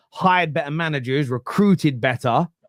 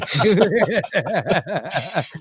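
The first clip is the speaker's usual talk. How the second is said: laughing; 4 semitones higher than usual. Real, not acted. Laugh at Drogba debate.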